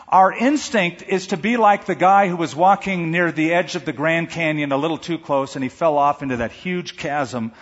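usual speaking voice

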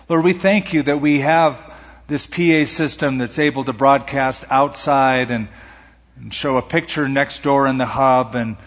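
earnest pastor's prayer. Acted. Pastor prays aloud.

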